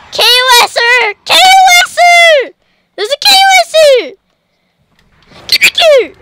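very high, loud yell; alarmed shout. Real, not acted. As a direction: scream a warning about an enemy. Spots an enemy player, alarm.

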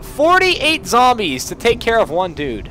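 high, loud protest; loud, high-pitched complaint; indignant outcry. Real, not acted. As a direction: exclaim in exasperated disbelief. Protests being swarmed in game.

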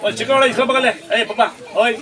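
high, loud call across the room. Real, not acted. Priest calling out to the little girl to come take the offering, high and loud. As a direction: shout over to get her attention.

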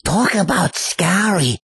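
Peter Lorre. Acted. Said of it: "This is Peter Lorre".

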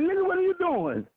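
high, mock incredulous question; imitated partner. Partner walks in on him flipping around, imitated disbelief. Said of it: "She come in the room like".

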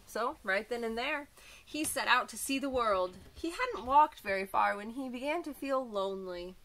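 bright, lively storybook voice; reading to children. A narrator's reading. Teacher reading a picture book to children, lively. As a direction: read it like a teacher to little kids.

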